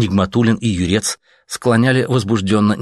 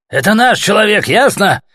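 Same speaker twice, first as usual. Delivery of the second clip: higher and much louder yell; 13 semitones higher, 7 dB louder than usual. A narrator's reading. Soldier yelling at the machine gun and snipers. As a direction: bellow it at the guns. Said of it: "заорал он пулемёту".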